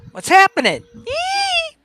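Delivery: high loud greeting into a squeal; shrieking hello; excited squeal. Pretend. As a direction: squeal with delight.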